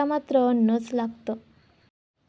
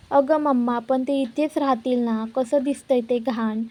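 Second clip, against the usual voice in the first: usual speaking voice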